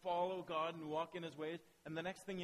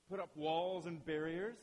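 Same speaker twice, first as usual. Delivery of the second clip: usual speaking voice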